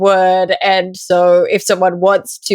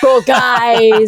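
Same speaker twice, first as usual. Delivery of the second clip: joking shout. Pretend. Joking echo of pun.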